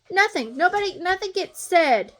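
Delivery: spitting out the frustration; exasperated outburst. Real, not acted. Stepmom ranting to viewers about her silent husband, exasperated.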